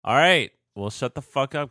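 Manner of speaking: loud, high snap at partner; yelling. Real, not acted. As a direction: yell it to cut them off. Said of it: "Don't yell at me".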